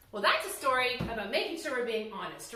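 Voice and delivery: ordinary delivery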